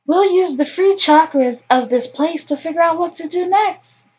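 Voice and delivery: high, put-on mystical voice; airy mystic character. Character proposing the free chakras, high mystical voice.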